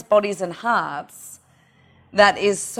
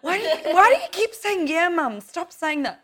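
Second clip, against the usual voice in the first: put-on higher, irritated teenage voice; mock annoyed teen; 8 semitones higher than usual. Daughter telling her mum to stop, in a higher irritated voice. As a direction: nag like a fed-up teenager. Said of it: "daughter scolding retold".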